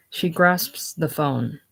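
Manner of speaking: ordinary delivery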